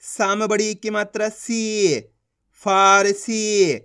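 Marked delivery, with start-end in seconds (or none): 1.3-2.0 सी with a long, held ee; teacher's drawn-out सी, falling at the end; stretched long ee. Shown as a demonstration. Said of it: "खींच खींच के उच्चारण करना है". 2.6-3.7 फारसी very slowly, long फा and सी; slow sounding-out of फारसी for learners; stretched vowels. Shown as a demonstration. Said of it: "खींच खींच के उच्चारण करना है".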